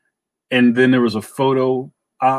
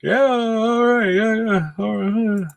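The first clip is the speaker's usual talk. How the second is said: high, drawn-out agreeable voice; put-on high voice, words run long and loose; Mike Norton impression; 10 semitones higher than usual. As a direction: stretch it out in Mike Norton's higher voice. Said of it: "Did you like my Mike Norton".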